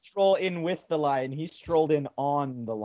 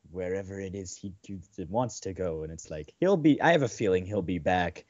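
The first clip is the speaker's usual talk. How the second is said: deep wise voice; 10 semitones lower than usual. Retold or imitated. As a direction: drop to a deep, sage-like intonation. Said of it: "it's something like".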